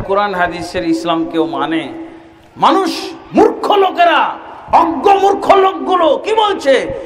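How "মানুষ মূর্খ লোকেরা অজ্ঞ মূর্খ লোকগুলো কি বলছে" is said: high, loud denouncing cry; scornful preaching shout. Acted. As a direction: cry out against the ignorant crowd.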